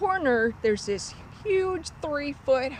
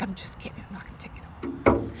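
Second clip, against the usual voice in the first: low-volume whispery aside; retold soft whisper; hushed backing down; 3 semitones lower, 4 dB quieter than usual. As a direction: drop to a whisper to take back the joke. Teller backs down to pouting child.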